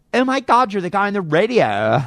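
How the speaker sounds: high, loud, excited gushing; Monica Lewinsky. Acted. Monica recognizes the radio host, high-pitched gushing impression. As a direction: squeal it in an exaggerated girly impression. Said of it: "she doesn't talk like that".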